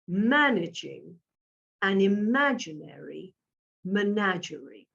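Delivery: deliberate, word by word with pauses; measured pace. Shown as a demonstration. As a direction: pace it evenly, a pause after each word. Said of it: "try to keep the pace to this".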